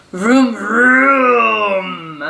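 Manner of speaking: drawn-out engine noise, mock sleepy; Mister Watson dreaming of a fast car, mock vroom. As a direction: make a dreamy car sound. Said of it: "said Mister Watson in his sleep".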